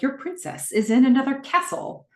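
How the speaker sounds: high-pitched teasing game line; mocking game quote. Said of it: "Gordon makes fun of the game".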